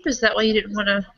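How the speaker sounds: ordinary talk, nothing marked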